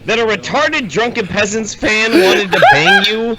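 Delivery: high, laughing through the words; can barely get it out for laughing; incredulous laughing rant; laughing disbelief. Real, not acted.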